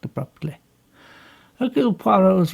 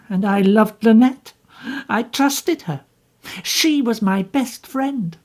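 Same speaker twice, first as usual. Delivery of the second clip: higher, bitter and defensive; bitter young woman; 7 semitones higher, 3 dB louder than usual. Acted. Jacqueline bitterly tells Poirot what happened. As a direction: push back at him with bitterness.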